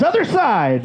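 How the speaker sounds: mock shout of a busy doctor; called out. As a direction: call it out brightly. Doctor calling out mid-procedure.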